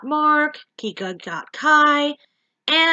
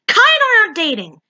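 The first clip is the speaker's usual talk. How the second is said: high, loud and fast snap; snapped; 10 semitones higher, 7 dB louder, faster than usual. Acted. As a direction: snap it quickly and loudly. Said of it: "she suddenly snapped".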